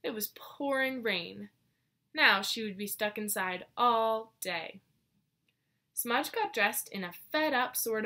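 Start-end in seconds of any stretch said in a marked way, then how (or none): none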